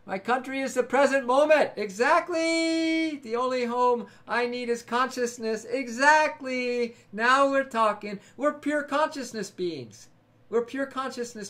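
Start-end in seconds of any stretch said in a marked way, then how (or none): 1.8-3.2 EXACTLY stretched very long and high; drawn-out agreement. Real, not acted. Emphatic agreement with a viewer. 5.7-6.9 EXACTLY stretched to double length; drawn-out agreement. Real, not acted. Emphatic agreement with a viewer.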